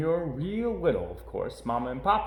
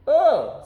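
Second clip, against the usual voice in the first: loud, high disgusted groan; a girl grossed out by a worm; disgusted ugh; 11 dB louder than usual. A narrator's reading. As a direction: recoil from the wormy apple. Sister finds wormy apple.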